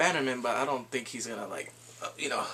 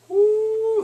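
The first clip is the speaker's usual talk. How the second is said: high, loud drawn ooh; loud stretched ooh; teasing ooh; 10 dB louder than usual. Real, not acted. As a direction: react like a crowd to a burn. Reacting to a burn.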